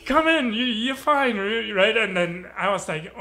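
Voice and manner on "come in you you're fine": raised, higher voice coaxing someone in; insistent encouragement. Retold or imitated. Friend urges nervous dancer into the circle, insistent encouragement. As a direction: urge a reluctant friend forward. Friend urging him to join.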